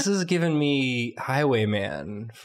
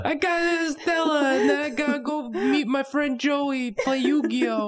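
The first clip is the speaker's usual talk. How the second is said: put-on high kid voice, Brooklyn accent; squeaky little girl, Brooklyn twang; Brooklyn Anya; 13 semitones higher than usual. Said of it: "Anya from Brooklyn".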